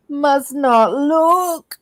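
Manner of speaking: slow, high, strained resolve; dramatic self-restraint. Retold or imitated. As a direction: drag it out like resisting temptation. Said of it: "and I'm just like".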